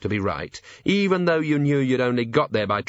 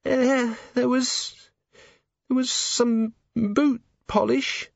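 put-on high, timid servant girl; nervous young maid; 14 semitones higher, much slower than usual. A narrator's reading. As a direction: stammer it out, frightened.

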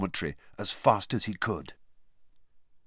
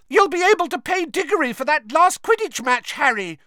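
loud, high-pitched excited shriek; high, full-voiced jubilant cry; 7 dB louder than usual. A narrator's reading. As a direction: shriek it over a cheering crowd. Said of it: "shrieked Katie Bell".

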